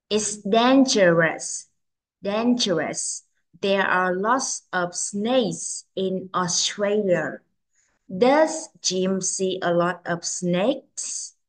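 slow, careful model reading. Shown as a demonstration. Teacher reads the third picture for children, very slowly. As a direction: stretch each word for learners to follow. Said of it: "Cô sẽ đọc rất là chậm".

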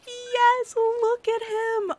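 very high, loud, slow delighted cry; squealing fan delight; thrilled squeal. Real, not acted. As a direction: shriek with joy at seeing him. Finally meets favourite character Nico.

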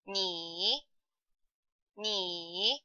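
ni held long, dipping low then rising; low "ni" that swings back up at the end; full third tone. Shown as a demonstration. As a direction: say "ni" with a complete dip and rise. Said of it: "the complete third tone is pronounced".

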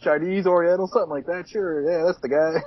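high, hesitant imitation; mock flustered receptionist. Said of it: "You're like".

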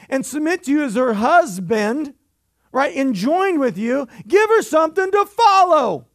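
high, loud preacher's shout; full-force preaching. Acted. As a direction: call the men out from the pulpit.

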